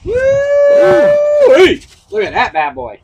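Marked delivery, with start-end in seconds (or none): 0.0-1.8 high, loud woo-wee shout; excited whoop. Real, not acted. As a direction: whoop with delight. Shout at the bourbon.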